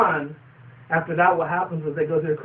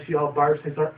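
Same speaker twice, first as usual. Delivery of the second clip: normal talking voice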